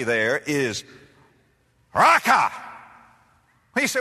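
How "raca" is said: contemptuous insult. Acted. Preacher spits the insult.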